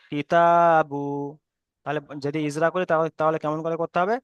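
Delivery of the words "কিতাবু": short কি, then a stretched তা in কিতাবু; lengthened middle vowel. Shown as a demonstration. Teacher joining the spelled syllables for students, long তা. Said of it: "এক কালি পরিমাণ টান দিয়ে পড়তে হবে".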